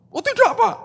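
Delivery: very high-pitched hasty protest; mock denial. Accused man denying to his pastor, mock protesting. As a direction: imitate someone squealing innocence.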